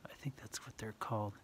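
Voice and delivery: unsure whisper to himself; whispered aside. Real, not acted. Reading to a child, an unsure whispered aside. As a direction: drop to a whisper, half to yourself.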